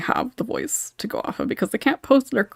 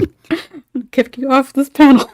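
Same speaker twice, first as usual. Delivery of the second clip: laughing mock threat; 7 semitones higher, 9 dB louder than usual.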